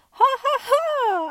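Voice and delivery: very high, loud, slow hearty laugh; cheery laugh. A narrator's reading. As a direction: laugh out big and jolly at the playing dolls. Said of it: "cried a cheery voice".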